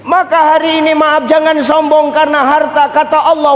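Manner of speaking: usual speaking voice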